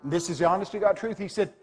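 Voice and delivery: ordinary talk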